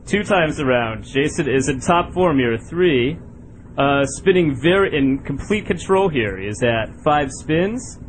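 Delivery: low, slow play-by-play commentary; sports commentator. Acted. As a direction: call it like a golf announcer. Play-by-play of spinning contest.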